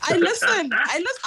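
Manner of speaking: loud, teasing brag; playful boast. Real, not acted. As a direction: show off playfully.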